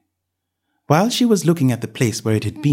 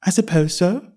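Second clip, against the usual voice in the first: put-on young girl voice; 7 semitones higher than usual. A narrator's reading. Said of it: "said Alice".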